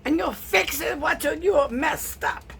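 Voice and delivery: hammed-up Russian-accented yelling; yelling Russian grandmother. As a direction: bellow orders like a furious Russian grandmother. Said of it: "she was yelling at me in Russian".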